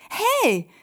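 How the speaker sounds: loud, high-pitched hey; calling out. A narrator's reading. Miriam interrupting the two, calling out sharply. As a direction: cut in with a high call. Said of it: "ruft dort Miriam".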